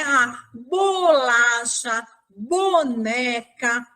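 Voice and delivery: bolacha, boneca drawn out very slowly; slow counting of words; slow recap. Shown as a demonstration. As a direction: stretch each word out for the children. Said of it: "quantas palavras a gente conseguiu".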